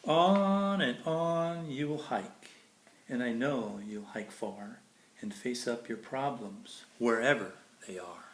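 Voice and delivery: bright, lilting storybook read; sing-song picture book reading; reading to children. Acted. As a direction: read it like bedtime verse for a child. Reads Dr. Seuss to kids.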